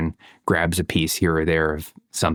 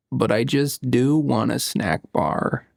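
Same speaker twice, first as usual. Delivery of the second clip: put-on slow, higher sulky little-boy mumble; muttering child; 4 semitones higher, slower than usual. Father imitating his son's sulky muttered admission, slow and higher. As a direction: mumble it like a pouting toddler. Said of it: "he mutters".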